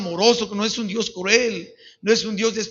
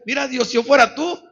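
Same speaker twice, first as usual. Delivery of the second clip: louder, higher retold bargaining with God; raised, frank plea; bold appeal to God; 4 semitones higher, 6 dB louder than usual. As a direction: re-enact telling God what to do. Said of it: "fíjate hasta cómo le decía".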